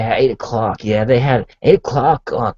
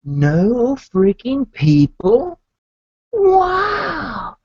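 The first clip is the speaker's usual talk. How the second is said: amazed; 10 semitones higher, much slower than usual. Retold or imitated. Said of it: "I'm like".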